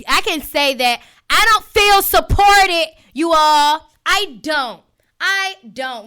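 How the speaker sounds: venting to her audience about missing support; frustrated outburst. Real, not acted. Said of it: "y'all can hear it in my voice".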